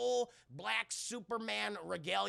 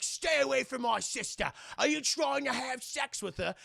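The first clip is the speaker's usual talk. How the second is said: loud, high, hammed-up protective brother; exaggerated tough-guy impression, pushed up and loud; Jason Statham; 6 semitones higher, 6 dB louder than usual. Brother warns man off his sister, loud mock outrage. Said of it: "Jason Statham's like".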